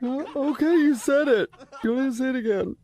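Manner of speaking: high, teary fake crying voice; crying on the phone. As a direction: sound wounded and near tears. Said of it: "Are you crying?".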